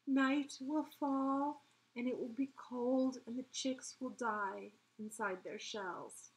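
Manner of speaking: slow, strained dying-bird voice; weak, hoarse warning, word by word. A narrator's reading. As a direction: draw it out slowly and mournfully. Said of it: "she said hoarsely".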